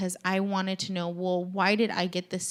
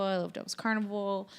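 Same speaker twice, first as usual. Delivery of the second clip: ordinary talk, nothing marked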